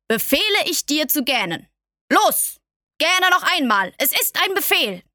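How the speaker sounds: a monarch saving face with a command; commanding king. A narrator's reading. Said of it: "sagte der König".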